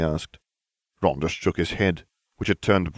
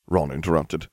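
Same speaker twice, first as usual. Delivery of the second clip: plain reading voice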